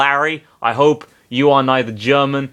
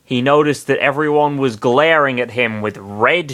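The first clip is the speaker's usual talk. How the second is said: ordinary delivery, nothing marked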